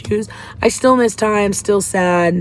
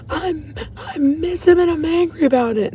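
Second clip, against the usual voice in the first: pitched high, mad and hurt; retold anger; 10 semitones higher than usual. Grieving sister admitting anger at her loss, raised pitch. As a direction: let the grief turn to anger. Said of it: "the first time that I really got mad".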